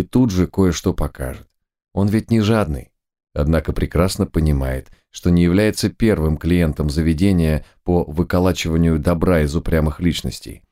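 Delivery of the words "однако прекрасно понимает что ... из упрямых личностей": ordinary delivery, nothing marked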